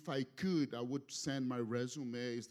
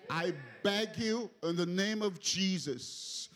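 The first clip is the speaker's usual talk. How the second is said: impassioned pleading; 4 semitones higher, 3 dB louder than usual. Real, not acted. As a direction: beg the congregation, building on each repeat. Preacher pleads with the congregation.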